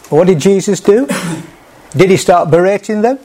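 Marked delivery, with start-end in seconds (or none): none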